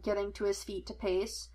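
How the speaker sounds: usual speaking voice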